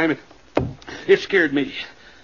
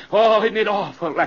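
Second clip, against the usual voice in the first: ordinary talk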